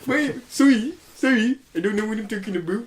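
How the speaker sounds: high, hammed-up Canadian voice; Canadian accent. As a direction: apologise like a cartoon Canadian. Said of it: "I need to stop the voice".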